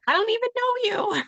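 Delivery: high-pitched mock disbelief; taken aback. Imagined prospect balking at a stranger's booking link, high mock disbelief. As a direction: voice shooting up, incredulous.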